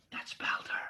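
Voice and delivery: hushed, soft whisper. Acted. Giantess guessing whose feet she sees, whispered. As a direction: breathe it as a secret realisation.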